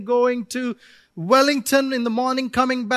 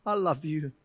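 quiet earnest prayer; 3 semitones lower, 3 dB quieter than usual. Pretend. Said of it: "and say".

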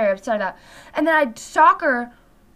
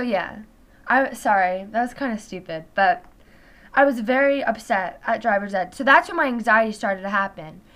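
ordinary talk

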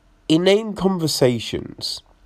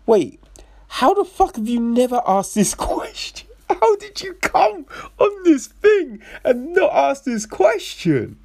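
can't believe nobody asked, higher and higher; incredulous disbelief; 12 semitones higher than usual. Retold or imitated. Mocking film characters who never asked, in high disbelief. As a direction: voice jumps much higher in disbelief, with a laugh.

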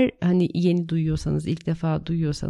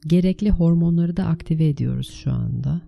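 guided meditation; 3 dB louder than usual. Acted. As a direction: lead them calmly into the meditation. (sakin rehberli meditasyon sesi)

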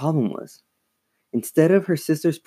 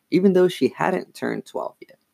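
plain reading voice

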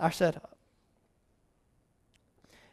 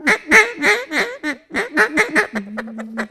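a long run of duck quacks, higher; duck calling; 5 semitones higher than usual. Shown as a demonstration. Hunter calling ducks in, rapid quacks. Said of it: "I just start working on 'em".

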